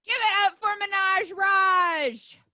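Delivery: high, loud, drawn-out introduction; emcee hype shout. Real, not acted. Emcee brings an act on for applause, hype shout. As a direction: shout the act onto the stage.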